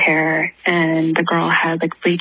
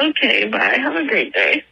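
normal talking voice

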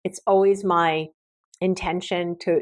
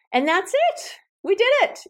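much higher, delighted little victory cry; triumphant cheer; 13 semitones higher than usual. Real, not acted. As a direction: celebrate finishing the episode. Celebrating finishing the episode.

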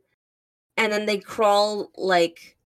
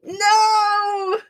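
mock wail; 12 semitones higher, 3 dB louder than usual. Mock protest to teasing.

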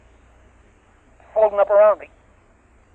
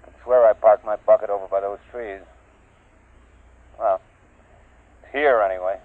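throat mic; 4 dB louder than usual. Acted. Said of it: "He had a throat mic on".

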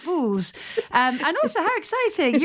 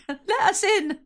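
laughing; 8 semitones higher, slower than usual. Real, not acted. Joking plea to be let in.

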